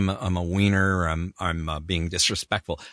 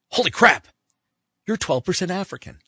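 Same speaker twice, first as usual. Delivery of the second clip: amazed; 9 semitones higher than usual. Retold or imitated. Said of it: "He goes".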